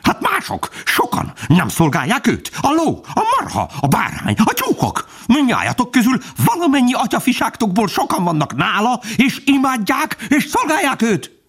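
extremely high, frantic dog voice; holy excitement. A narrator's reading. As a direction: protest in piercing, fanatical fervour. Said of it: "A kutya táncolt szent izgalmában". (szent izgalomban, sipító hangon)

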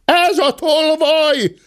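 high, slow accusing shout; maid's cry. A narrator's reading. Maid accusing the gentleman of theft, high cry. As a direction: stretch the accusation out on a high pitch. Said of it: "kiáltotta a szobalány".

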